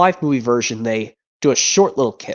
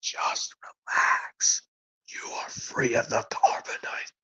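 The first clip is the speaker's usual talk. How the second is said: slow breathy whisper, masked bounty hunter; hushed whisper behind a helmet; disguised bounty hunter whisper; 11 dB quieter, slower than usual. Acted. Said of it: "another impression from this movie".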